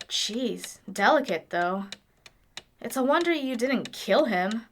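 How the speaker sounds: ordinary talk, nothing marked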